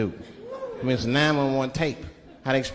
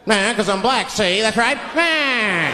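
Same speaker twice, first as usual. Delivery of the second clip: forties bad-guy voice, pitched up; cocky crook voice rising to a higher drawn-out finish; talking crazy; 5 semitones higher, 3 dB louder, much slower than usual. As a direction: cut loose like an old movie gangster. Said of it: "I'll talk crazy".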